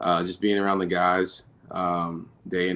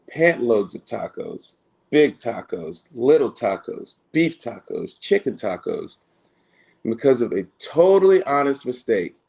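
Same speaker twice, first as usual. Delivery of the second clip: louder, higher, lively storybook read-aloud; reading to children; 4 semitones higher, 6 dB louder, slower than usual. Acted. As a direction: read it big and animated for the kids at the event. Reads picture book to children.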